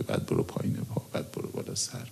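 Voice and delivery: hushed reading. Retold or imitated. Reading ritual steps from prayer book.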